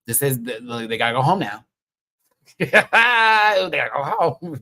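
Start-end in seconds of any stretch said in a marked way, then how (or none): 1.7-4.6 laughing. Real, not acted. Laughing while insisting on eliminations.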